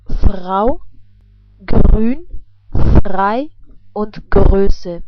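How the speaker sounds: Frau, Grün, Frei, Größe with a throaty R. Shown as a demonstration. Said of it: "pronounced like that before vowels".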